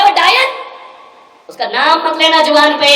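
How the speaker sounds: loud, high scorn; loud, hateful daughter; venomous outburst. Retold or imitated. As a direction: spit out डायन at the mother-in-law. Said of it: "बोले".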